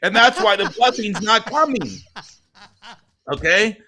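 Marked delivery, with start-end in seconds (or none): 0.0-2.0 building from firm to high and loud; impassioned confession. Retold or imitated. Father admitting to his son he played God, rising fervour. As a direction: preach it with mounting force.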